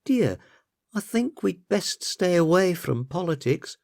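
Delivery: high-pitched wifely voice; temperamental wife. A narrator's reading. Wife cautions her husband at dinner.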